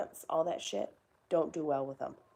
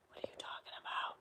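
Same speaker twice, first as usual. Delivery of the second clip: breathy whisper, barely voiced; 10 dB quieter than usual. Real, not acted. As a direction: whisper it as if confused. Repeats the comment in a whisper.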